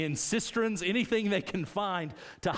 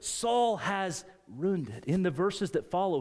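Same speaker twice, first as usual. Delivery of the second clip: ordinary delivery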